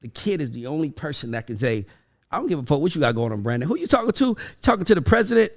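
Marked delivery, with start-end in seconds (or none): 2.3-5.5 put-on entitled kid. Child demanding to be fed by a busy parent, bold and higher. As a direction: play a kid who cares nothing for titles.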